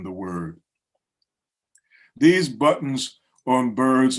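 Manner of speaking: deliberate recitation, strong at stanza openings; measured, emphatic poem delivery; poetry reading. Acted. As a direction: read it as verse, pausing after each phrase. Reads own poem aloud.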